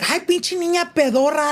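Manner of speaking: slow, high, put-on mean dad voice; mock mean dad. Friend pretending the stepdad insulted her, mock mean dad voice. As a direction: sneer it like a rude father. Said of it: "stepdad was never like".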